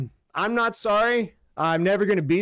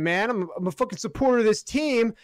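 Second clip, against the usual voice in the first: normal talking voice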